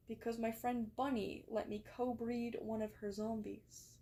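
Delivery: ordinary delivery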